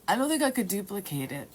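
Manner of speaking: usual speaking voice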